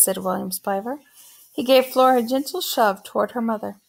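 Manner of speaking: plain reading voice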